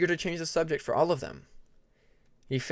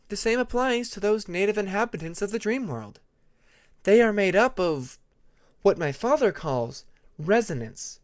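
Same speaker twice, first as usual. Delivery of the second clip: put-on higher, patient young dragon explaining; Tempest voice, explaining; 6 semitones higher, 3 dB louder than usual. A narrator's reading. Tempest explaining the dream world to her friends, lightly higher voice. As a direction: lay it out gently for friends, voice raised.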